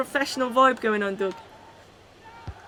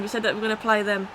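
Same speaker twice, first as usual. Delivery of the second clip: usual speaking voice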